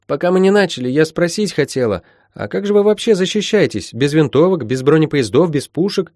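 curious Molly. Acted. Molly asking how they defend themselves, eager and curious. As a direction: pile up questions, wide-eyed.

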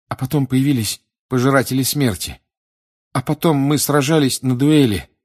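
put-on high, loud, agitated boy; shaken report. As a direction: pour out the ordeal. Shocked boy recounts duel. (взволнованно, высоко и громко)

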